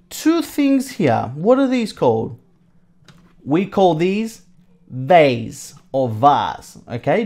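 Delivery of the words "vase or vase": slow, each "vase" said a different way, one like "vayss" and one like "vahz"; two pronunciations of vase. Shown as a demonstration. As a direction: spell out the two ways to say "vase", slowly. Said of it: "Depends on where you come from".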